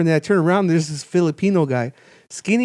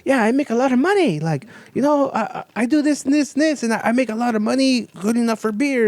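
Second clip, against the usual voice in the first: higher-pitched voice for the retold guy; 8 semitones higher than usual.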